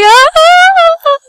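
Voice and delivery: high, loud mock wail; long imitated cry of a brother sliding away. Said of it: "like, crying".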